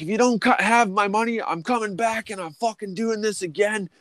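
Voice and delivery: high strained demand; retold demand. Worker demanding his pay at the boss's house, high. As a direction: bark for your money. Threatening after firing shots.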